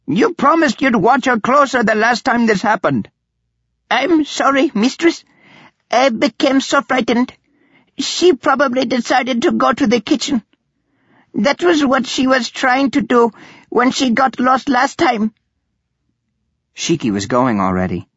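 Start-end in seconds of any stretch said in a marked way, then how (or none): 0.1-3.1 high-pitched cross scolding; fuming. A narrator's reading. Mistress blaming Subradil for losing the aunt, fuming. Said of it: "Narita fumed". 3.9-15.3 high-pitched, pleading servant voice; apologetic servant. A narrator's reading. Servant explaining the lapse to her angry mistress, apologetic.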